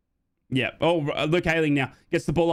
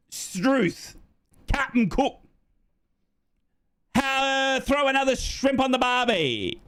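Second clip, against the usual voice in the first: high-pitched cartoon Aussie; hammed-up Australian accent; 9 semitones higher, much slower than usual. Hams up Australian identity.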